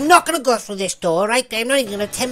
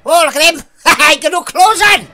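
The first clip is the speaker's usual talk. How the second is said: loud, high, laughing exclamation; shouted through laughter; amused outburst; 6 semitones higher, 11 dB louder than usual. Real, not acted. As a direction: hoot with delight at a silly sight. Amused by naked game figure.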